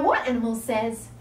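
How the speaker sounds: normal talking voice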